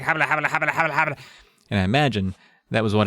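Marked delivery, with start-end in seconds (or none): 0.0-1.2 tongue-tied babble. Retold or imitated. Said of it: "nothing would come out".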